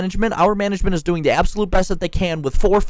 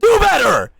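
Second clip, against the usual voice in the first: mock yell; 9 semitones higher, much slower than usual. Employee imitating a boss turning on them, mock shouting. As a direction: snap from chummy to shouting. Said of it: "they'll come up and be like".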